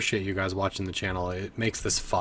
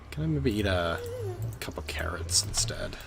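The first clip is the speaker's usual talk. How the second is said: whisper; 5 dB quieter than usual. Real, not acted. Aside while eating in game.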